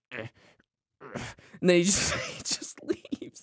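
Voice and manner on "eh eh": very soft strangled eh sounds; imitating Frank's twitch noise. Retelling Frank's eye twitch, soft strangled sounds. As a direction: choke out tiny strangled noises. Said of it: "he goes".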